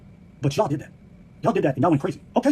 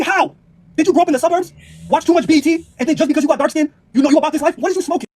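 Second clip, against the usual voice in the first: incredulous rant; 7 semitones higher, 5 dB louder than usual. Acted. Scolding quote to the hosts.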